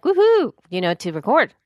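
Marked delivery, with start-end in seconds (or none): 0.0-0.5 high happy whoop; cheering. Retold or imitated. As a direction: whoop it up with joy. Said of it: "I was like".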